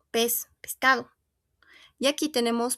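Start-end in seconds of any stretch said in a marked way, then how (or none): none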